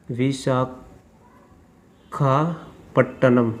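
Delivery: Visakhapatnam broken into pieces with long pauses; slow dictation. Real, not acted. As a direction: say Visakhapatnam very slowly, as if writing it down. Spelling out city while writing. (Visakhapatnam को टुकड़ों में, रुक-रुककर बोलना)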